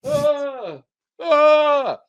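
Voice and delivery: high, loud mock scream of a terrified kid; imitated child scream. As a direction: scream like a scared little kid dangled over gators. Dad dangling child over alligators.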